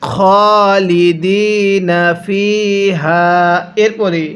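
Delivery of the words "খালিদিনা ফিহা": slow, drawn-out recitation of খালিদিনা with long vowels; Quran recitation. Shown as a demonstration. Teacher modelling খালিদিনা for viewers to repeat, slow recitation. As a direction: recite খালিদিনা slowly, stretching each vowel. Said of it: "হ্যাঁ, পড়ুন".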